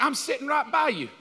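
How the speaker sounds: jealous husband protesting to his wife, indignant. Retold or imitated. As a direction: play the offended husband beside her. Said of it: "I'm looking at her like".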